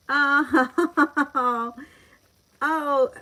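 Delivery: high, loud "oh" breaking into laughter; delighted laughter. Real, not acted. Streamer reacting to a viewer from Ireland, delighted laughing. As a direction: exclaim and laugh out loud, really tickled.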